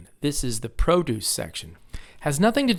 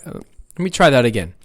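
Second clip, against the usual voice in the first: normal talking voice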